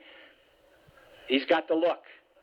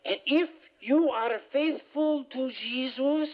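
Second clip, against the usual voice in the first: slow, higher mock French preacher; French accent; 6 semitones higher, much slower than usual. Retreat-goer mimicking the preacher's French accent. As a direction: imitate the French retreat leader, slow and lofty. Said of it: "he's got a French accent".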